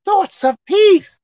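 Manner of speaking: slow scripture reading, broken into phrases. Shown as a demonstration. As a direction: stretch the words and pause after each phrase. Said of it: "let's look at it one more time".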